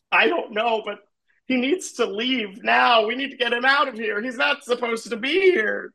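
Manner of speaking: high-pitched, distressed little Smurf. Acted. Smurf tells another the stranger must go, distressed. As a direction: fret in a high voice, urgent to get rid of him.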